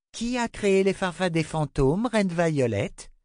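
put-on a child saying sorry to the queen; young girl Rachel. A narrator's reading. Girl apologizing to the queen, meek.

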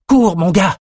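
high, urgent cry to run; shouted urging. A narrator's reading. Lila urges Bannon to run, shouting. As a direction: shout it while fleeing. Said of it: "cria Lila".

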